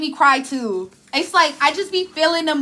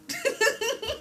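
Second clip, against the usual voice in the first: soft breathy laugh; 13 semitones higher, 10 dB quieter than usual. Real, not acted. Laughing at herself.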